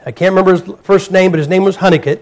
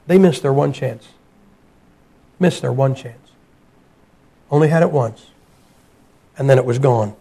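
hushed solemn warning; 6 semitones lower, 7 dB quieter than usual. Acted. Preacher hushing the congregation over the missed chance, grave and soft. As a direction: let the loss sink in quietly.

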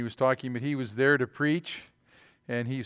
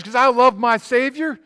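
excited worshipper; 5 semitones higher, 8 dB louder than usual. Retold or imitated. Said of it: "he got all excited about her".

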